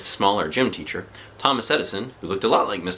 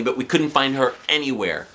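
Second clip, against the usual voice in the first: plain reading voice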